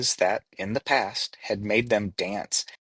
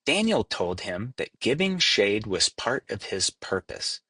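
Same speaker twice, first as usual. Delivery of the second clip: ordinary delivery, nothing marked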